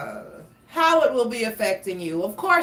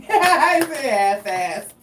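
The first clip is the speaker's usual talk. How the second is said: slow, louder laughing words; 5 semitones higher, 6 dB louder, slower than usual. Real, not acted.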